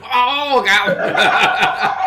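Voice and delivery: laughing hard through words. Real, not acted. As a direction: crack up while talking. Laughing at friend spewing food.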